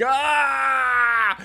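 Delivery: high burst of a shout; overwhelmed yell; mock scream. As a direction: let out a sudden comic scream. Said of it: "You're like".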